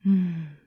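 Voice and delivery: a loud, pleased mmm of taste; savoring mmm. Acted. Witch tasting the tavern keeper's stew, pleased mmm. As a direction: hum your delight at the food.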